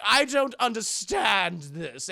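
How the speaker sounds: slow, drawn-out mock critic disdain; haughty critic. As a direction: stretch it out like a dismissive art critic. Retold haughty art critic.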